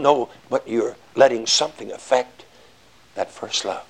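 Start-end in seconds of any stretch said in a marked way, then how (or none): none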